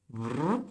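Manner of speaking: short soft "brrr" sound effect; soft vocal swoosh, rising; imitated stroke noise. Shown as a demonstration. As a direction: make a quick trilled noise for a pen stroke.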